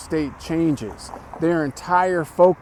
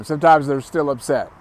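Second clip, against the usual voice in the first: normal talking voice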